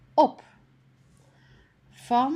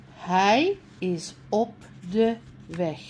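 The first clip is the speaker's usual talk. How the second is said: ordinary delivery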